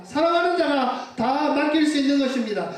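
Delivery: ordinary delivery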